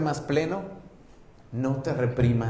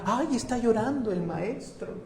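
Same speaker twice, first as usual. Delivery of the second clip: mocking onlooker; 10 semitones higher than usual. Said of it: "Hizo un show para que todos vieran".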